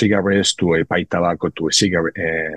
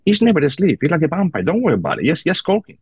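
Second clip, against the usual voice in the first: higher-pitched, animated quoted voice; animated retelling; 8 semitones higher, faster than usual. Retold or imitated. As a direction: act out an insistent recommendation, pitched up. Acting out father persuading his son.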